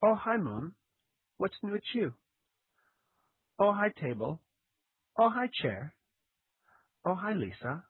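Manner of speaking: a loose, lightly voiced Tommy Wiseau read; hammed-up cult movie line reading; Tommy Wiseau impression. Friend reading a spoof book as Tommy Wiseau, mock dramatic. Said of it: "I would start with".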